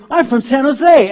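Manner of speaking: high, loud cry of recognition; excited hometown greeting. Acted. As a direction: stretch it out, overjoyed at meeting a fellow American. Excitedly answering fellow American in London.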